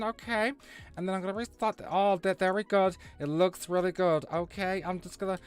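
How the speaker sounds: high-pitched, fussy parody of a know-it-all; mock specialist. Streamer parodying a specialist fiddling with the stream, mocking. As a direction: put on a high voice, tacking on okays.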